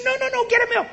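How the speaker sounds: very high, bossy little squeak; tiny Minnie Mouse voice. Retold or imitated. Tiny wife ordering her big husband at McDonald's, Minnie Mouse voice. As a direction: order him about in a cartoon-mouse falsetto. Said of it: "tiny little Minnie Mouse voice".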